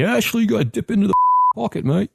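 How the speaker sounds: bad, high Geordie accent; a Newcastle fan begging in a poor Geordie accent. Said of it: "that's a very bad, um, Geordie accent".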